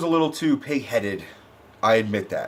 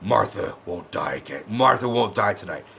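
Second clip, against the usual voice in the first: low, hushed hero voice; Batman; 6 semitones lower, 4 dB quieter than usual. Retold or imitated. Reviewer imitates Batman vowing to save Martha, low mock-solemn voice. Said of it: "He's like".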